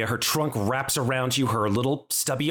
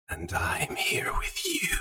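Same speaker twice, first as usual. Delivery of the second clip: slow, eerie whisper; alien voice; 5 dB quieter, slower than usual. Acted. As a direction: whisper softly like a presence inside the mind. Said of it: "you hear an alien voice".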